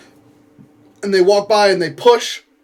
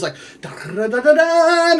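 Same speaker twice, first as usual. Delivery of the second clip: scurrying noise; 10 semitones higher than usual. Retold or imitated. Mimicking monster scurrying in circles.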